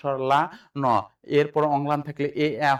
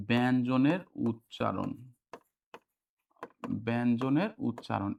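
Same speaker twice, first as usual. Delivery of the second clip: slow dictation; 4 semitones lower, 5 dB quieter, slower than usual. Shown as a demonstration. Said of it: "একটু খেয়াল করেন ভাইয়া".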